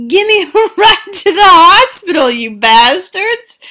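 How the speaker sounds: high, loud shouting demand; mock yell. Shot bodega owner yelling at fleeing van, loud and high. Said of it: "Bodega owner comes out and is like".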